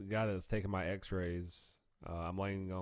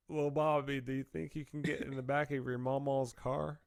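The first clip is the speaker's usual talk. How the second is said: a lightly raised, coaxing grown man; Big's coaxing voice; 5 semitones higher than usual. Retold or imitated. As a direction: gently coax the hurt kid. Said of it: "And he's like".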